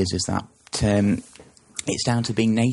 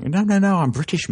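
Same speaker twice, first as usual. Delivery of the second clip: high, louder indignant protest; mock offended denial; 10 semitones higher, 4 dB louder than usual. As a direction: play the huffy Brit denying it. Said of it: "they'll be like".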